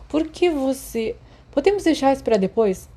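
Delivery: irritated. Acted. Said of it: "Remus disse irritado".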